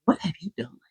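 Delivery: stunned, breathless whisper; a whispered imitation of Varys in awe; awed whisper. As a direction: gasp it out in disbelief. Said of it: "looking around going".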